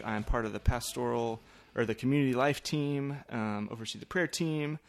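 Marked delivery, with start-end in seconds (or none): none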